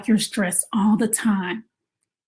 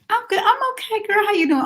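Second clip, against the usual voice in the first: bright, much higher put-on happy voice; fake cheerful; 12 semitones higher, faster than usual. Mom pretending to a friend she's fine, fake cheerful high greeting. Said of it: "We pretend".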